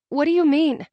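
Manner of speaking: high, loud, alarmed call; startled question. A narrator's reading. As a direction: call after him in confusion. Heroine calls after him.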